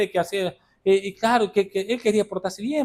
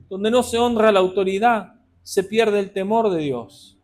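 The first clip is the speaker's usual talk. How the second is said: loud, driving sermon delivery, pitch raised; fiery preaching; 6 semitones higher, 5 dB louder than usual. Acted. Preacher charging the church about honoring authority, fiery preaching. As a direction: preach it hard to the congregation.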